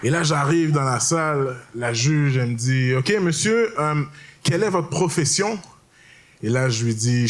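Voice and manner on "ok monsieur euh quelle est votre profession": a lightly voiced judge, higher and prim; imitating the judge. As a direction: play the judge politely asking, pitched up.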